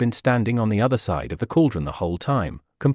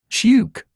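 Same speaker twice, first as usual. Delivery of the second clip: a loud high jolt of a sound; sharp startled yelp; 11 semitones higher, 5 dB louder than usual. A narrator's reading. Narrator voicing a sudden scare, loud and high. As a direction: make the sudden shock noise.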